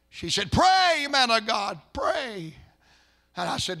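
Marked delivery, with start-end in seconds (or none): 0.5-2.5 high, loud wail, easing off at the end; imitated weeping plea. Weeping wife begs the pastor to pray, high wail. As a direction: cry out, begging for prayer.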